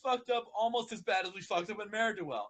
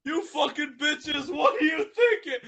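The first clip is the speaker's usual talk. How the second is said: louder, higher furious rant; angry Spike; 6 semitones higher, 7 dB louder than usual. Acted. Spike raging at the ponies. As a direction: rage at them.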